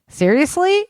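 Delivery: a startled, rising Seriously; surprised. A narrator's reading. Soldier surprised by the major's calm answer, high disbelief.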